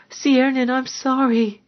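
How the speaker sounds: high, frightened apology; whimpering. A narrator's reading. Frightened woman apologising to her lover, whimpering. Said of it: "she whimpered".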